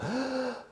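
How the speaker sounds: audible breath drawn in; sharp inhale. Shown as a demonstration. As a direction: demonstrate breathing in. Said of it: "I'm breathing in".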